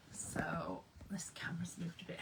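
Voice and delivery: quick whisper under the breath; fast whispered aside. Real, not acted. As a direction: hurry it out in a whisper. Muttered aside about camera.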